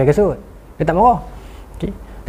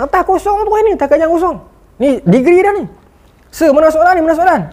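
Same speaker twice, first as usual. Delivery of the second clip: much higher complaining student voice; protesting students; 11 semitones higher than usual. Retold or imitated. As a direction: whine like confused students. Pelajar protes kertas kosong. (pelajar merungut dengan suara jauh lebih tinggi)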